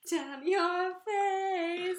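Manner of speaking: high, slow words through laughter; laughing. Real, not acted. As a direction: stretch it out while cracking up. Teasing retelling, laughing.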